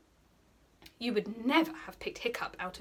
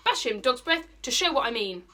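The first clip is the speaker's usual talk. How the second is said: louder, commanding bully; bossy order; 3 semitones higher, 7 dB louder than usual. Acted. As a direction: bark the order at the sidekick. Bully orders his sidekick.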